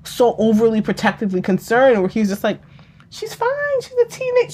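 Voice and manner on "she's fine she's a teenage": much higher, softer breezy imitation; nonchalant stepfather. Stepfather brushing off the overprotective mother, nonchalant. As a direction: wave the worry away in a light, high voice. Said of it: "nonchalant nature that was like".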